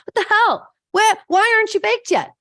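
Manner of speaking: loud, high exasperated yell; fed-up baker yelling into the oven; frustrated yelling. Retold or imitated. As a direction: lose patience with the cake out loud. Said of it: "Start getting frustrated with the cake".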